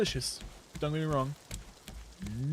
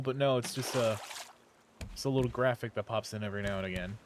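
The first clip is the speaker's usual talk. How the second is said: ordinary delivery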